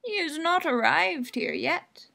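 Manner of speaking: put-on high, slow old crone; old woman. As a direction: creak it out like an old gardener. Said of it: "said an old gray-haired woman".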